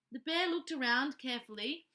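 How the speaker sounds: ordinary delivery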